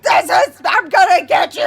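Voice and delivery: loud, high charging shout; full-throated acted war cry; battle yell. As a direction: yell it as you charge at a wolf. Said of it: "like, yelling, like".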